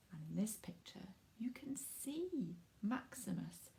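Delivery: whispered aside. Real, not acted. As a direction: whisper it as if sharing a secret. Picture aside to children.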